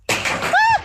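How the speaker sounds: sudden high shriek of fright; startled scream. Real, not acted. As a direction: shriek as snow drops on you. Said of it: "Aku takut".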